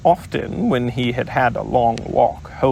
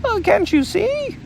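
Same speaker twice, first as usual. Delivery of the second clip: shouting Piglet. Acted. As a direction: shout it high in frustration. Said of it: "shouted Piglet".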